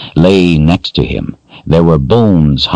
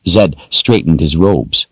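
plain reading voice

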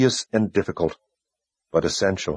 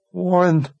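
a high, drawn-out anguished moan of his name; mild high moan; 11 semitones higher than usual. A narrator's reading. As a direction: grief breaking out as she sees him. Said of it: "Verna moaned".

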